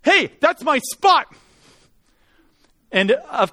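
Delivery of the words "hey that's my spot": higher, louder call out the window; retold yell. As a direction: yell it like an angry driver. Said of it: "he yells out his window".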